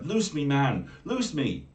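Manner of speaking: high, loud frightened mermaid; cried out in terror. Acted. Bound mermaid crying to be set loose, frightened. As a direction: cry out to be freed. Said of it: "she cried out with terror".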